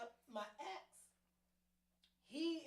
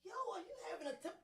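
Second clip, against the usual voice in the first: high, fast, laughing outburst; fed up, laughing and shouting it out; mock panic at his tantrum; exasperated; 9 semitones higher than usual. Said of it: "it was like".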